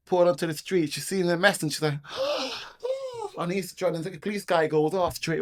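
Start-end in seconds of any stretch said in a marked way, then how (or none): 2.1-3.3 very high imitated gasp and "oh"; high-pitched acted shock. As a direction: gasp in mock horror. Acts out her shock at the mess.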